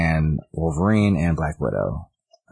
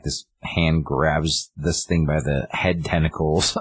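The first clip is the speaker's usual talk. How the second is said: ordinary talk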